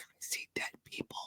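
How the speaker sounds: hushed movie-quote whisper; frightened-child whisper. Retold or imitated. Host quotes Sixth Sense to show good acting, whispering. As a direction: imitate a haunted child whispering a secret. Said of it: "This is like Sixth Sense. Remember?".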